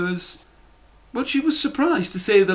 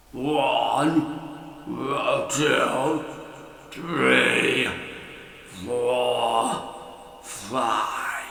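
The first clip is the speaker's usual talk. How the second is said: counting lower and softer as he dozes off; sleepy giant counting; 3 semitones lower, 5 dB quieter, much slower than usual. A narrator's reading. Giant counting his gold as he nods off. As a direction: let the count sink into sleep.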